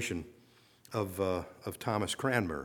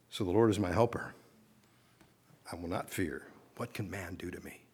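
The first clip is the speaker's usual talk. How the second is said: quiet affirmation; 5 semitones lower, 6 dB quieter than usual. Acted. Quiet close of the point.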